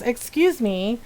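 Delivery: ordinary talk, nothing marked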